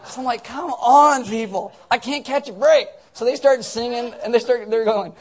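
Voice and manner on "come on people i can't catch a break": loud, high mock exasperation; comic exasperated outburst; irritated. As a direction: plead in hammed-up frustration. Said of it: "I'm all, like, irritated".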